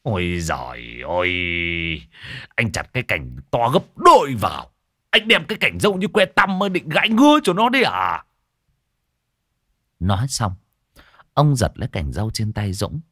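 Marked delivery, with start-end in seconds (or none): 0.0-8.2 scornful, climbing much higher and louder; mock the tiny twig in a loud outburst; exasperated shout. Father mocking his son's thin twig, loud exasperated shout. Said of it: "ông quát".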